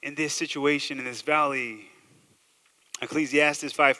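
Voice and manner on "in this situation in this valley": mock desperate bargaining. Believer stuck in a jam bargains with God, mock desperate. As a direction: bargain urgently, hammed-up. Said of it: "when we're stuck in a jam".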